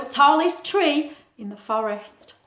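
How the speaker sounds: ordinary delivery, nothing marked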